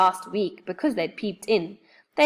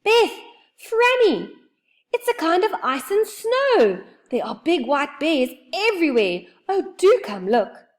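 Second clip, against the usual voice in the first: much higher, louder calling down; excited shout; 9 semitones higher, 4 dB louder than usual. Acted. Joe shouts down to the girls about the snowy land. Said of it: "He gave a shout".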